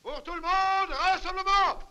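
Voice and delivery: loud high parade-ground shout; shouted command. Acted. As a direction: bark it across the parade ground. Sergent rassemble la troupe. (ordre crié à pleine voix)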